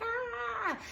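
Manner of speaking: mock banshee shriek, high but held back; banshee scream. Quoting commenters who scream in their house, restrained high banshee scream. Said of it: "I scream like a banshee".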